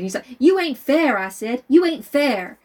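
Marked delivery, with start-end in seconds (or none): 0.4-1.2 put-on child's protest. Child Scout talking back. 1.7-2.6 put-on child's protest. Child Scout talking back.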